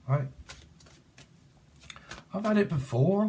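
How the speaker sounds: normal talking voice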